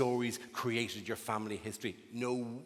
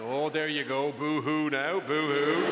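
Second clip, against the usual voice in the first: mocking comfort; 2 semitones higher, 5 dB louder, slower than usual. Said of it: "I'd be there going".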